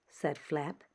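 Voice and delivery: usual speaking voice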